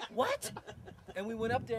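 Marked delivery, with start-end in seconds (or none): 0.1-0.5 high louder incredulous what; a sudden much higher disbelieving question; startled what. Retold or imitated. Told his ear is cut off, answering with a high startled what. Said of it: "And I'm like".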